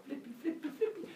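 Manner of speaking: soft, very high squirrel chirp; high, light cheerleader squeak. Acted. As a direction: chirp it like a little cartoon squirrel.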